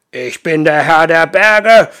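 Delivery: loud, slow booming mountain lord; booming giant. Acted. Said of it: "mit seiner grollenden Stimme".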